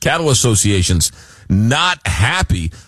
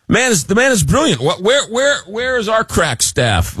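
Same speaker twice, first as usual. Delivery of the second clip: mock amazement; 10 semitones higher than usual. Real, not acted. Host jokingly marvels at guest.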